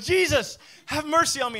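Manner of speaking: loud, high shout for help over a crowd; calling out. Acted. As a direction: cry out to someone you cannot see. Blind man calling out over crowd.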